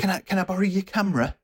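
high, quick mock sweet ask; eager girl.

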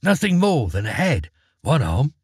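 plain reading voice

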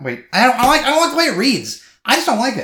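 loud, high exasperated complaint; frustrated protest. Real, not acted. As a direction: break off reading, exasperated. Frustrated with the wording mid-read.

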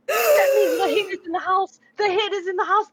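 high, loud eager kitten cry; thrilled squeal of discovery; excited. Acted. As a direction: bounce with glee at the news. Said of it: "jumping up and down excitedly".

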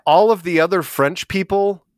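ordinary talk

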